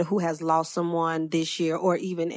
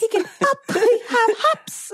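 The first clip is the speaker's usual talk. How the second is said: laughing squeaky voice; 5 dB louder than usual. Real, not acted. Laughing playful voice on hops.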